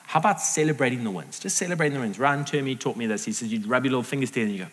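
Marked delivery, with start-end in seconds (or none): none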